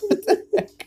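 high, laughing what the heck; laughing disbelief. Retold or imitated. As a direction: squeak it out through laughter. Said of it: "I'm like".

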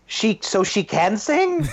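high, slow, stunned disbelief; surprised. Retold or imitated. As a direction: gasp it out in shock. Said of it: "and I was like".

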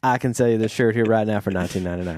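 quick Southern salesman pitch; Southern accent. Shown as a demonstration. Co-host pitching a t-shirt in a test, Southern accent. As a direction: sell it fast in a Southern accent. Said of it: "sound better in a Southern accent".

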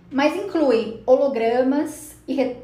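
ordinary talk, nothing marked

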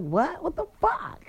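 high-pitched, indignant outburst; retold heated reaction. Woman replaying her reaction to the message, heated. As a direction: replay being hot about it, pitch jumping up. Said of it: "I was still hot".

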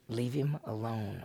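soft, slow and firm; quiet divine command; hushed warning. Acted. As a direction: drop to a grave murmur. God's dream warning voiced.

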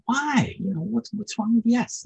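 high, baffled angel; surprised why. Retold or imitated. The angel questions the shopkeeper, high and baffled. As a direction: push up in disbelief.

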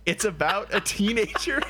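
high voice breaking into laughter; laughing while talking. Real, not acted. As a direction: barely get it out through laughs. Cracking up at show's premise.

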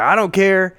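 high, loud angry cop. Retold or imitated. Cop yelling at the rider about the bike. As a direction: yell it right in his face. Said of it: "he gets loud instantly".

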